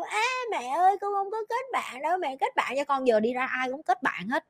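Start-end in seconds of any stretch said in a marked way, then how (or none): 0.0-3.1 put-on higher, soft whining child voice; a timid girl clinging to mom; a lightly voiced whimpering daughter; shy whiny child. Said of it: "thấy ai cũng".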